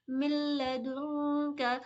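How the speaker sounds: मिल्लदुन का with the नून of दुन hidden in the nose before का; hidden nasal noon. Shown as a demonstration. Teacher demonstrates ikhfa to students, slow and nasal. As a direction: slowly hum the नून of मिल्लदुन through the nose. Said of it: "नून के sound को नाक में छुपा कर पढ़ेंगे".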